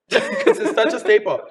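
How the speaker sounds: high, laughing through the words. Real, not acted. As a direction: say it through a giggle. Laughing after own remark.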